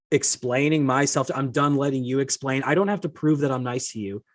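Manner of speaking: ordinary talk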